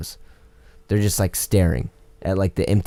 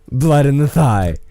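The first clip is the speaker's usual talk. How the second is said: slow, loud and higher; exasperated emphasis; 6 semitones higher, 6 dB louder, slower than usual. Retold or imitated. Telling the customer, slow loud emphatic retort. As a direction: spell it out with exasperation.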